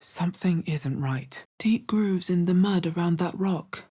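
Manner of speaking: ordinary talk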